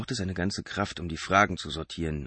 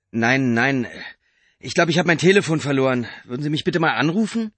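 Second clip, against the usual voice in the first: higher, louder hurried excuse; nervous Mark; 8 semitones higher, 7 dB louder than usual. A narrator's reading. Mark pleading with the driver, nervous and higher. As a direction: talk fast to cover the lie.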